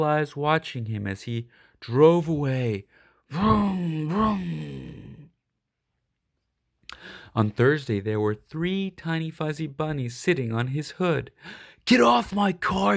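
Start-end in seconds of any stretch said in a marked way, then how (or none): 3.3-5.3 engine vroom. A narrator's reading. Engine sound imitated. 11.8-13.0 higher, louder shout at the bunnies. Acted. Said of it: "Mike shouted".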